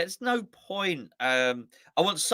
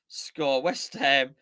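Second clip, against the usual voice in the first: normal talking voice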